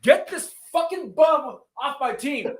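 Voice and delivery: high, slow, disgusted yell; retold furious yell. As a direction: boot the player off your team in disgust. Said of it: "I was like".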